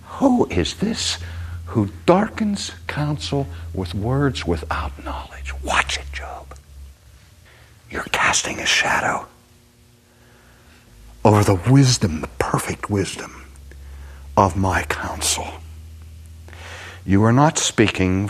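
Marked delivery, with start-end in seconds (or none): 0.0-5.5 high slow who, then softer, slower whisper; voice of God. Acted. God rebuking Job, rising then hushed. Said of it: "God says". 5.6-15.5 hushed warning. Acted. God warning Job, hushed.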